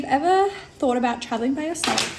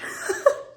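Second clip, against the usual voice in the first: short high real giggle; laugh. Real, not acted. Vlogger reacting to a protein bar's calories, brief high laugh.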